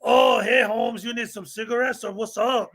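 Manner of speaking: put-on Mexican accent. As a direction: imitate a customer in a Mexican accent. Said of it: "I didn't do the voice".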